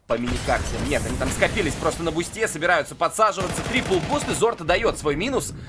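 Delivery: usual speaking voice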